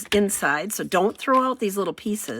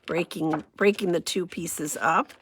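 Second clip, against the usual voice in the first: ordinary talk, nothing marked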